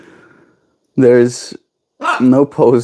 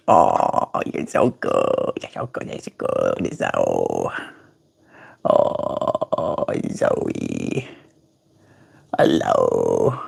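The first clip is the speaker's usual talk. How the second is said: deep breathy low whisper; 8 dB quieter, much slower than usual. Retold or imitated. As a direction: whisper low and slow to the bird. Imitating low whispered bird flirting.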